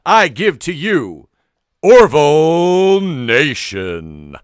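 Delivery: booming, slow ring announcer hype. Shown as a demonstration. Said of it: "introduce our show ... ring style".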